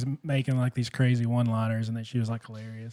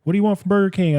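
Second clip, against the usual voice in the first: grandparent asking; 7 semitones higher, 5 dB louder, faster than usual. Retold or imitated. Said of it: "my grandparents were always like".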